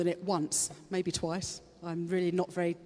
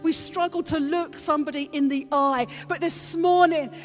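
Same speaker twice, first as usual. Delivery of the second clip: high, loud sermon delivery; fervent Easter preaching; passionate preaching; 6 semitones higher, 4 dB louder than usual. Acted. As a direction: drive the message home to the congregation. Preaching at full force.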